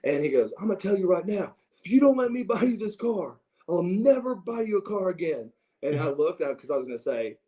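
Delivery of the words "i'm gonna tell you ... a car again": high, heated dad imitation; acted frustrated threat, pitched up; exasperated ultimatum. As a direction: lay down the law as a fed-up father.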